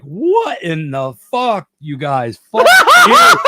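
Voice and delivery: stunned shout breaking into laughter; laughing shout. Retold or imitated. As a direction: yell it at your friends mid-trip while laughing. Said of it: "I'm like".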